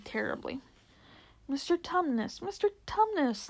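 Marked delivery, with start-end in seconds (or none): 1.5-3.5 high, pleading distress; great distress. A narrator's reading. Lucy calling to the weeping fawn, in great distress. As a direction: call the name higher the second time, distressed. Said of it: "Lucy said in great distress".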